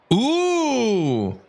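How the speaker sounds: ordinary delivery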